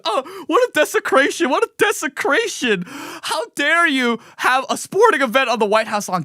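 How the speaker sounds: high, mocking pearl-clutching voice; mock outraged liberal. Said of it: "They're like".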